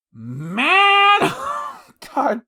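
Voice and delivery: loud, high drawn-out cry; exasperated outburst. Real, not acted. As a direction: let out a big exasperated wail. Venting about a hard game.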